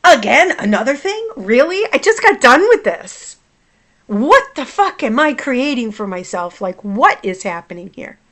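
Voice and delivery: loud, high exasperated questions; angry outburst. Retold or imitated. Woman asking herself what she is creating, exasperated anger. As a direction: burst out in disbelief, then come down at the end. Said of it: "I'm angry because".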